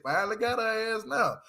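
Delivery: ordinary talk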